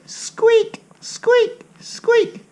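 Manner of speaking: very high squeaky squeak; high-pitched squeaking rat; mouse squeaks. Shown as a demonstration. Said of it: "can you do it?".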